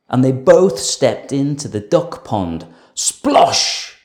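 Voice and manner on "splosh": ordinary delivery, nothing marked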